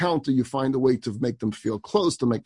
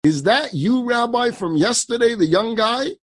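higher, louder call through the wall; 9 semitones higher, 3 dB louder than usual. Retold or imitated. Elderly woman on the porch calls to the young rabbi. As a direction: call out to him from behind the slats. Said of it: "she yells out".

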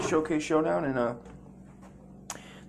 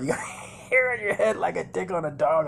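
uncle's teasing; 5 semitones higher than usual. Retold or imitated. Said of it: "like my uncle used to say".

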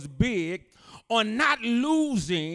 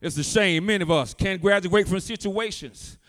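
loud, driving pulpit preaching; full-force preaching; 9 dB louder than usual. Acted. Pastor preaching hard to the congregation, loud and driving. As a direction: preach it out to the back pews.

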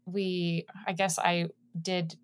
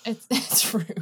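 laughing; 3 semitones higher than usual. Real, not acted. Mock complaint, laughing.

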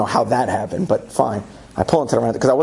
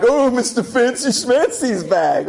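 mocking; 10 semitones higher, slower than usual. People in line mocking him.

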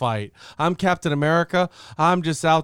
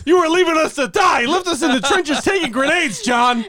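much higher, louder chewing out; mock furious rant; 14 semitones higher, 4 dB louder than usual. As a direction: yell at the teammate who abandoned you. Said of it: "I was like".

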